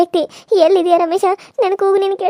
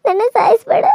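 higher, crying begging; sobbing plea; 3 semitones higher than usual. Acted. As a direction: sob out the plea for her life. Victim begs for her life.

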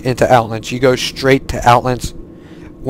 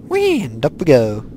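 playful whee; 3 semitones higher than usual. Pretend. Playful cry as ride lifts off.

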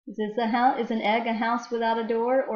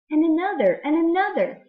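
building excitement; 8 semitones higher, 7 dB louder than usual. Acted. Dinosaurs piling out of book.

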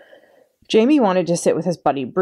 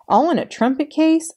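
surprised; 11 semitones higher than usual. A narrator's reading. Boy balking at packing a trumpet case, surprised. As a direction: squeak out disbelief.

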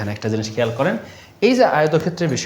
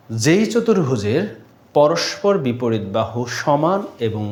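slow, measured dictation, phrase by phrase; slower than usual. Shown as a demonstration. Teacher dictates the rectangle definition to students, slow and measured. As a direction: read the definition slowly for note-taking. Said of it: "তারপর লেখেন".

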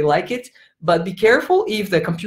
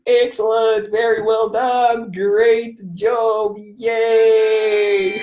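higher, sing-out teacher cheer; excited praise; 3 semitones higher, much slower than usual. Pretend. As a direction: cheer on young pupils. Praises imagined pupils in game.

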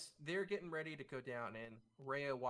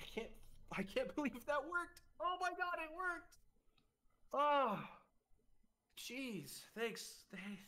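very high, laughing delight; ecstatic disbelief; 13 semitones higher, slower than usual. Acted. Priestess laughing to herself after the ritual works, ecstatic and high. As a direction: giggle through it in disbelief. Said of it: "starts laughing to herself ... ecstatic".

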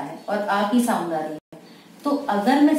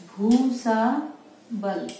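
slow dictation; much slower than usual. Shown as a demonstration. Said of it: "तो कैसे लिखा".

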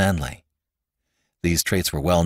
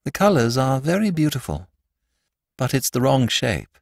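higher, dreamy musing; dreaming; 5 semitones higher than usual. A narrator's reading. Priest brooding over the dagger's shape, dreamily. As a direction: drift through it as in a dream. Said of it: "in a low, dreaming voice".

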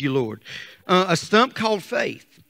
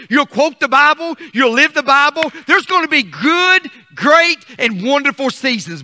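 building, higher and louder preacher's cadence; preaching; 7 semitones higher, 5 dB louder than usual. Acted. Pastor preaching seasons of life to the church, rising fervour. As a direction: climb into full preaching fervour.

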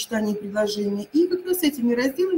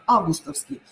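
Августовский stressed on the opening А; Августовский, first syllable stressed. Shown as a demonstration. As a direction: put the stress at the very start of Августовский. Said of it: "ударение поставить на А".

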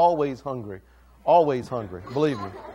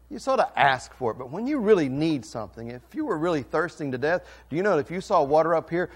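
ordinary talk, nothing marked

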